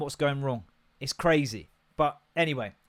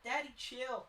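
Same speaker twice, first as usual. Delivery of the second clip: dog voice; 9 semitones higher, 14 dB quieter, slower than usual. Pretend. Voicing dog.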